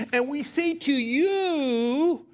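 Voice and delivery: Sarah Palin impression. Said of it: "a voice that is not Sarah Palin's".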